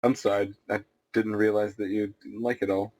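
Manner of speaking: normal talking voice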